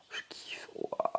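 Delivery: breathy soft whisper. Real, not acted. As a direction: whisper it in admiration. Awed whisper.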